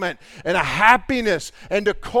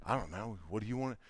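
mumbled; 9 semitones lower, 17 dB quieter, faster than usual. Retold or imitated. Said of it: "he mumbled something".

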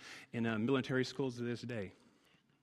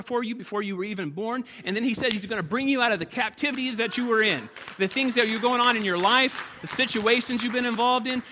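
high, loud, driving preacher's build toward the altar call; preaching at full force; 9 semitones higher, 8 dB louder than usual. Acted. Pastor urging the church to seek God, preaching at full force. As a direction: preach it hard, pushing every line louder and higher.

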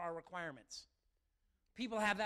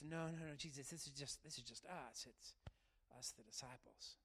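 timid refusal; 5 semitones lower, 10 dB quieter than usual. Retold or imitated. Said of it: "What if they had just".

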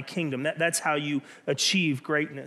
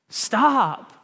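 a firm, raised stop, lightly voiced as God; 4 semitones higher, 5 dB louder than usual. God stopping Todd, a firm raised call. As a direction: halt him with one firm word. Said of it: "And God's just yelling".

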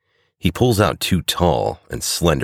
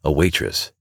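ordinary delivery, nothing marked

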